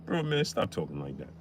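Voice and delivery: low and soft hushing; cool them down smoothly. Retold or imitated. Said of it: "[name] called right in".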